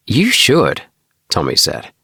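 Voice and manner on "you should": quick bright reply, higher; lightly voiced eager boy; eager friend. A narrator's reading. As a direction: answer with eager certainty. Tommy answers eagerly.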